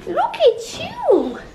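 very high, slow cooing squeal. Real, not acted. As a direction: stretch it out in a squeaky coo. Cooing at pet.

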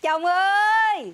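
very loud, high, long-held call. Acted. Wife calls her husband on coming home, shouting. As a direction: shout for your husband, stretching it out.

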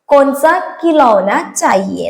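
spelled out slowly word by word; slow it right down for the learners; teacher's slow, clear demo; slow model sentence. Shown as a demonstration. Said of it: "എന്ന് വെച്ച് കഴിഞ്ഞാ ഏത് കളിപ്പാട്ടം വേണം?".

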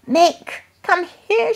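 high, loud shout from the window. A narrator's reading. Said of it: "shouted Mister Brown".

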